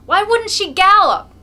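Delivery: high, loud aggrieved roar; angry retort. A narrator's reading. Mokolka retorting to the crowd, angry. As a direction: bellow the complaint. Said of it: "Mokolka's angry retort".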